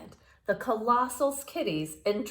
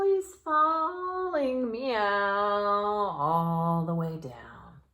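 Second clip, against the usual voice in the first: falling cat meow; much slower than usual. Pretend. Reader playing the cat tumbling down. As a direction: stretch it like a cat falling away.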